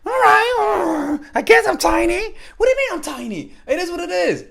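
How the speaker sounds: high, squeaky mock offended girl; offended woman voice. Date reacting to being called tiny, high mock indignation. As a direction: play the confused date, huffy and high. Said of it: "She'll be like".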